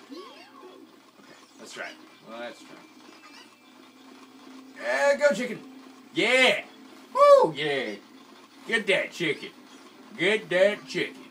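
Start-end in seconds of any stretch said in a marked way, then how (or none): 4.8-11.2 loud, high cheering; excited cheering. Real, not acted. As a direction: cheer the chicken on. Cheering on the chicken in game.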